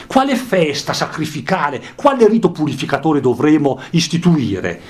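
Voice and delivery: dramatic reading. Acted. Teacher rereading the madman's words to students, declaiming. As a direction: proclaim the death of God.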